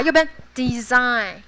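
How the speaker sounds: "design" slow, s voiced as z. Shown as a demonstration. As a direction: stretch out a buzzing z. (design 慢慢念出 z 音)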